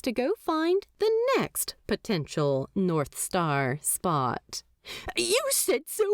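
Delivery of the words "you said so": high, flustered angel voice. A narrator's reading. Aziraphale flustered after calling the demon rude, high-pitched. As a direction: stammer it, caught off guard.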